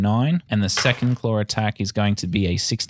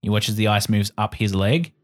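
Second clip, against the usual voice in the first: ordinary delivery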